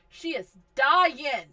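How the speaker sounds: slow loud fed-up wail; annoyed exclaim. Real, not acted. Annoyed at dying sim.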